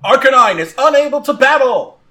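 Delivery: loud, high ringing call; referee's announcement. Acted. Referee declares Arcanine out, announcing loudly. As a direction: proclaim the knockout to the arena.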